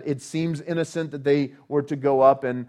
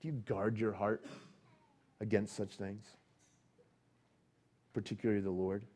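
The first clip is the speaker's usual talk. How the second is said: much softer, with long pauses; hushed searching question; 4 semitones lower, 11 dB quieter than usual. Acted. Preacher probing the listeners' hearts, hushed. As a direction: ask it gently, almost under the breath.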